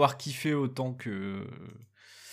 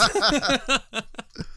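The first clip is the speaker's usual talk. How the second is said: high laugh; 12 semitones higher than usual. Real, not acted. Laughing at the pun.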